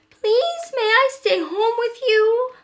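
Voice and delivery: put-on very high, loud pleading child's voice; begging little raccoon. A narrator's reading. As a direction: plead desperately like a little kid.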